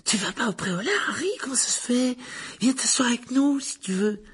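very high, put-on child's voice; eager young boy. A narrator's reading. Young admirer invites Harry to sit, eagerly. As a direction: pester keenly in a squeaky high pitch.

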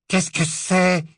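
high, cold questioning woman; icy woman's voice. Acted. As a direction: ask coldly from behind the gate. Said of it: "demanda la voix glacée d'une femme".

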